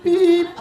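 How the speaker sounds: high, loud imitated beep; machine beep. Between phone messages, high loud mock beep. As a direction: squeak it out like the machine.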